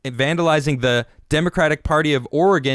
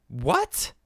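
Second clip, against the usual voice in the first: incredulous what; 8 semitones higher, 6 dB quieter than usual. Real, not acted. Incredulous at question.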